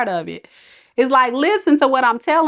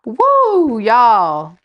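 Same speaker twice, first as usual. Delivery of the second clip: loud exasperated woo; 5 dB louder than usual. Real, not acted. To the viewers in her chat, a loud exasperated woo. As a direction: let out a loud woo at them.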